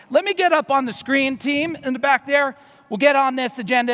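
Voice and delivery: ordinary talk, nothing marked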